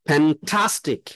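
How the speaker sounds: Fantastic with a loud, punched fan; stress on fan. Shown as a demonstration. Teacher showing the class English stress, hitting fan. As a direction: lean hard into fan in fantastic. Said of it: "stress দিতে হবে".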